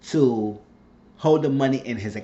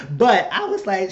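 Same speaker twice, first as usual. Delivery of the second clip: laughing through the words, a little higher; 8 semitones higher, 4 dB louder than usual. Real, not acted. Recapping the show and laughing at her own rant, amused. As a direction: chuckle it out.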